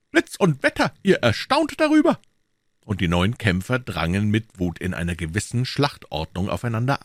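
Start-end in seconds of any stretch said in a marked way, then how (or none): 0.1-2.2 very high, taunting outburst; exclaimed oath. Acted. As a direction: swear and taunt them at a high pitch. Exclaimed oath before the fight.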